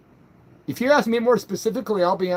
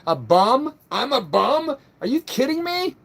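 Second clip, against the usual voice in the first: rising outrage, pitch climbing higher; can't believe he was called a bum; indignant disbelief; 6 semitones higher than usual. Real, not acted.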